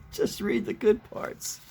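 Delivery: put-on high-pitched little-kid voice. Kid asks Papa to skip ahead, high child voice. Repeats the kid's line.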